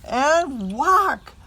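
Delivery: sudden loud burst. A narrator's reading. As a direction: punch out the whack. Cow kicks in story.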